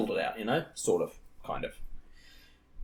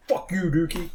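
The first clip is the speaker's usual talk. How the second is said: higher, louder playful jeer; raised-voice mock abuse; mock shouted insult; 9 semitones higher, 5 dB louder than usual. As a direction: hurl it as a joking insult. Acting out greeting the hated Dookie.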